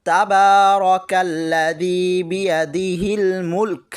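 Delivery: slow recitation, stopping on মুলক; recitation with pause. Shown as a demonstration. Teacher showing how to read when pausing for breath. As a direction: recite it slowly and rest on মুলক. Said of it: "তখন এভাবে পড়বেন".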